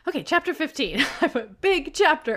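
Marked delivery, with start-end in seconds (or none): 1.6-2.4 high, loud mock headline; exclamatory. Reading out own chapter note, exclamatory. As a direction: punch it out like an exclamation point. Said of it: "exclamation point at the beginning".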